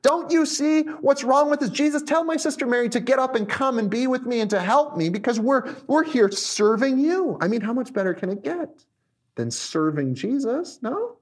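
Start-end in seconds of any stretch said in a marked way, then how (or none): 0.0-7.4 high, hurried exasperated complaint; frustrated. Acted. Martha complaining to Jesus about her sister, frustrated. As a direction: plead indignantly for someone to step in. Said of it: "she gets so frustrated with her and says".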